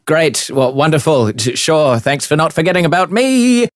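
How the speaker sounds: the left-out bard complains to the party, high and sarcastic; Aiko's sulky voice. Acted. GM voices Aiko.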